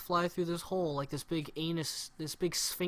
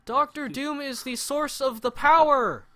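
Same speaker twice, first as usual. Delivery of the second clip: mock wooden hero line, higher and louder; badly delivered hero line; 9 semitones higher, 10 dB louder than usual. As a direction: ham up a clunky blockbuster line. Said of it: "worst delivered lines".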